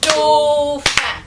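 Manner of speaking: "Dauphin" split into two clear syllables, dau-phin; syllables split apart. Shown as a demonstration. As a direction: clap out "Dauphin" one syllable at a time. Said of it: "combien t'entends de syllabes". (« Dauphin » détaché en deux syllabes)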